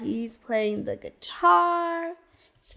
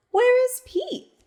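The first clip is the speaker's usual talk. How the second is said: high, louder teasing question; playful question; 11 semitones higher, 3 dB louder than usual. Acted. Storyteller quizzing children, playfully high. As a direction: quiz the kids brightly.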